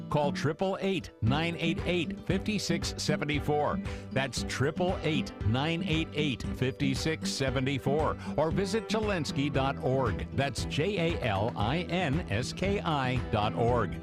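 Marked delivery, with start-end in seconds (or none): none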